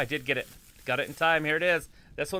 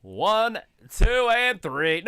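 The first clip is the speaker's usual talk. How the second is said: excited count; 7 semitones higher, 6 dB louder, much slower than usual. Real, not acted. Counting the pin.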